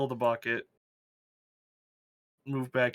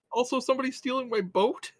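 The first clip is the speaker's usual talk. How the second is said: indignant; 11 semitones higher, 4 dB louder than usual. Real, not acted. Outraged at boat theft.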